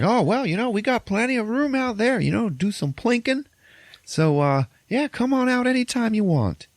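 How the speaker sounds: very high, hammed-up farmer voice; eager farmer. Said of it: "the farmer guy".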